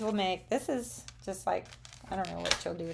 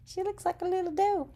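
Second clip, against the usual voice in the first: put-on much higher, quick cooing baby talk; cutesy voice; 10 semitones higher, faster than usual. Crafter coos over her stamped deer in a high cutesy voice. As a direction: coo it like it's adorable. Repeats remark in cute voice.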